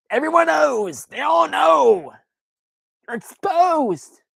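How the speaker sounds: high, slow theatrical cry; mock outrage. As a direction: wail it like a scandal. Mock-dramatic exposure.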